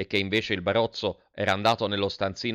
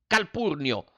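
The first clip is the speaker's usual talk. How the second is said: exclaiming; 6 semitones higher, slower than usual. Acted. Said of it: "esclamò".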